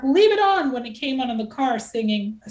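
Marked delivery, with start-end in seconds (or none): 0.0-0.7 loud, high barked order. Retold or imitated. Patrice in the car wanting his song, loud and high. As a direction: bark the order at the radio. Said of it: "He would bark".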